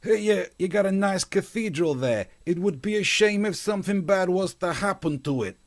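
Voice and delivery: high-pitched mock gangster menace; veiled threat. Host mimicking a threat to the bishop, mock menacing. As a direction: put on a thug making a thinly veiled threat. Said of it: "it's got that vibe of like".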